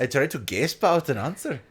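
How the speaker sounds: mock Norse accent. Friend jokes with the Old Norse word, mock Norse accent. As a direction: ham up a Norse accent, gasp as "gaysp". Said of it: "You're the one doing all the accents".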